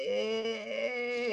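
long, drawn-out "eh" noise; dragged-out whine. Retold or imitated. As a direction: imitate her droning word endings. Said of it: "every word is like".

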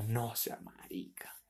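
slow hushed whisper of the friend's retort; mock whisper. Retelling the friend's hushed scolding reply, slow whisper. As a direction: whisper it, slowly, as if scolding in secret.

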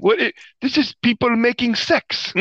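high, hammed-up French accent; mock French accent. Host mocks movie dialogue, high fake French accent. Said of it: "she's like".

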